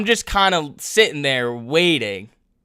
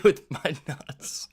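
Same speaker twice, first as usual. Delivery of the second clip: stifled laughter; 4 dB quieter, slower than usual. Real, not acted. Breaks up at his punchline.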